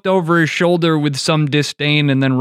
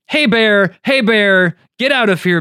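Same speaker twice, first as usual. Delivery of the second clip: higher-pitched calling out at a bear; mock yelling; 8 semitones higher, 4 dB louder than usual. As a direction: call out as if warding off a bear.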